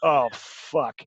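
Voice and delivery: low, sinking mock dismay; retold upset. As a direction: imitate a fed-up man groaning it under his breath. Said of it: "he was so upset".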